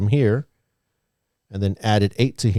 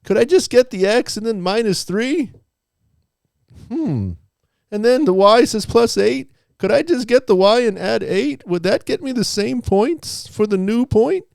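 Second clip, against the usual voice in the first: put-on much higher, louder kid voice; eager student making a case; imagined student voice; 12 semitones higher, 4 dB louder than usual. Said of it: "And they said".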